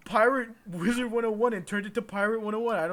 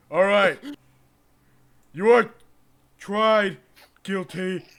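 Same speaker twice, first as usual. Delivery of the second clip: loud judge; 10 dB louder, slower than usual. Acted. Judge shouting the verdict.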